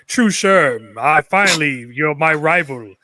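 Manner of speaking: a lightly voiced evil doer; hammed-up threatening villain. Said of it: "this evil doer type".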